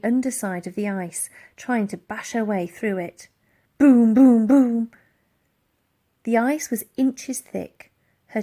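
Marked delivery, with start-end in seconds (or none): 3.8-4.9 loud, punchy boom sounds; booming thumps. A narrator's reading. Narrator booms out Elsie's fists hitting the ice.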